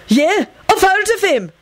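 very high, frantic pipe-up; frantic yell. A narrator's reading. Benji flatters the dead man to his captors, frantic. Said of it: "Benji yelled frantically".

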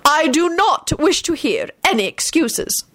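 high, slow, stern reprimand; icy headmistress. Acted. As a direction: shut the pupil down, word by word.

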